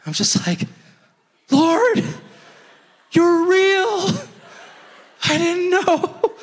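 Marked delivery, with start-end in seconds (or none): 1.5-6.3 high, loud, stunned realization; amazed to God. Retold or imitated. Preacher stunned that God answered him, high amazed voice. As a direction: gasp it out like a revelation.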